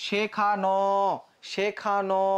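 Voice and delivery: শেখানো with the final no held long; drawn-out ending. Shown as a demonstration. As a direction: stretch the last o of শেখানো. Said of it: "তুমি টানতে পারো".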